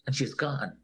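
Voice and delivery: normal talking voice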